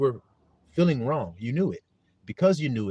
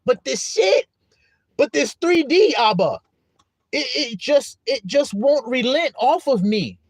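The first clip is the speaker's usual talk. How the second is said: high, loud acted frustration; exasperated complaint; 7 semitones higher, 4 dB louder than usual. Believers complaining it won't relent, acted exasperation. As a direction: plead in a raised voice, acting fed up. Acts out people struggling with temptation.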